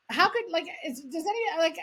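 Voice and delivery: high-pitched, stumbling disbelief; retold incredulity. Fifteen-year-old baffled that nobody else laughs, incredulous.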